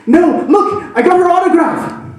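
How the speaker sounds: high, loud pleading defence; husband pleading his case to his doubting wife, high and loud; eager protest. Retold or imitated. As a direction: insist he really got it. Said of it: "And he's like".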